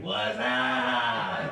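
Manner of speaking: long drawn-out goofy greeting; showy grand entrance. Acted. Comic retelling his magical arrival at Taco Bell, showy drawn-out greeting. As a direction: stretch the greeting out, hammed-up. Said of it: "puff of smoke, and I showed up like".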